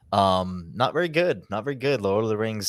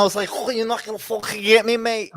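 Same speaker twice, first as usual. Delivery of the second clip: loud, high mock Liverpool accent; hammed-up Scouse fighter's rant; exaggerated attempt at a thick Scouse accent; Darren Till; 13 semitones higher, 10 dB louder than usual. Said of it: "I'll listen to Darren Till talk".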